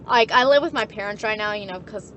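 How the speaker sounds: usual speaking voice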